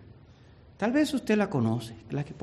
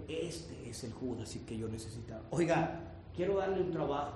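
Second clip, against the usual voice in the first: hushed discovery; 3 semitones lower, 11 dB quieter than usual. Retold or imitated. Painter quietly finds Judas model.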